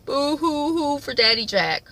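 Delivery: high, pretend boo-hoo wail; hammed-up weepy whine; mock crying. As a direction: fake a sob in teasing sympathy. Mock weeping over absent father.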